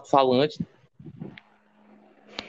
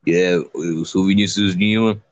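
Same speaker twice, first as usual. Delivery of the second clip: much lower mock host voice; imitating deep voice; 9 semitones lower than usual. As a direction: drop the voice low and imitate him. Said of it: "Ficou tipo assim, ó".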